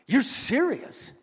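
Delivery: high, loud, drawn-out incredulous question; imitated disbelief. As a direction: stretch it out as the stunned coworker. Coworker's stunned question, retold.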